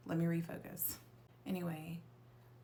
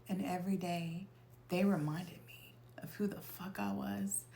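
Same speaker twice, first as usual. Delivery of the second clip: ordinary delivery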